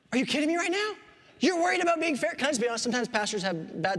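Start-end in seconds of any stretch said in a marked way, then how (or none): 0.1-2.3 higher-pitched, incredulous parent; can't believe the kid is complaining; retold disbelief, pitched up; exasperated parent. Exasperated parent's reaction to son.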